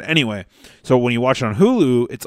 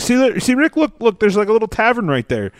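high, excited little voice; Morty quote; 10 semitones higher than usual. Retold or imitated. Retelling Morty spotting the tavern to Rick, high eager voice. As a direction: imitate an eager cartoon sidekick, pitched up. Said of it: "he's like, he's like".

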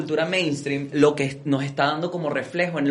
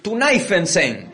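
mock broken English; 2 semitones higher, much slower than usual. German and Venezuelan exchange.

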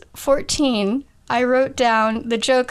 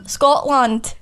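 put-on Scottish pronunciation; Scottish accent. Shown as a demonstration. Guest showing the host an accent, put-on Scottish. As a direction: say it as a Scot would. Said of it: "like, Scottish".

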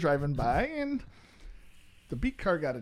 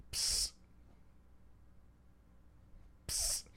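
spray hiss; 15 dB quieter than usual. Retold or imitated. Spraying sound imitation.

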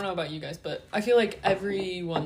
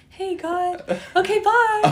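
put-on high, breezy kid rattling off a prayer; little kid voice; 13 semitones higher, 6 dB louder, slower than usual. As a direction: chirp it like a small child, laughing. Little kid's quick prayer.

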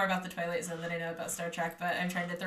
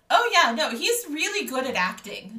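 surprised realization; 6 semitones higher, 5 dB louder than usual. Retold or imitated. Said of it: "and I'm like".

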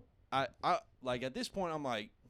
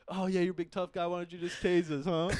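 higher, sneering taunt; mocking taunt; 4 semitones higher than usual. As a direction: goad him mockingly. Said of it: "just being like".

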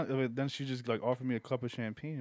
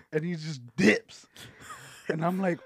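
usual speaking voice

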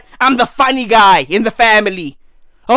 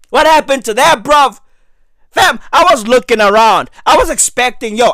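ordinary talk, nothing marked

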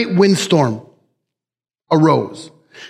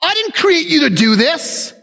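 "I didn't create you" raised high and scolding; scolding rebuke; 6 semitones higher than usual. Retold or imitated. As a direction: chide it like a child. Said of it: "like he was rebuking this child".

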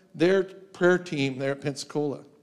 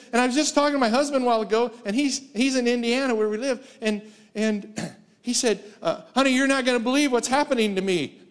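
quoted woman; 8 semitones higher than usual. Retold or imitated. Woman retells husband's call.

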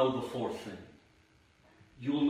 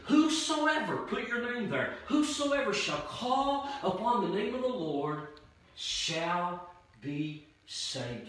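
preacher ringing out a Bible verse; preached scripture; 5 semitones higher than usual. Real, not acted. Reciting scripture with force.

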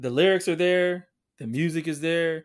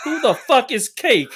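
mock outrage; 10 semitones higher, 7 dB louder than usual. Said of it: "I'm like".

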